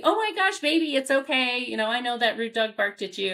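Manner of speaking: mock fussing owner; coddling baby talk. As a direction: gush over the baby. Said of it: "sometimes our response can be".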